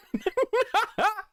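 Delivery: squealing laugh, way up high; shrill delighted cackle; high burst of laughter. Real, not acted. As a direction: let a cackle break out at your own joke. Laughs at own insult.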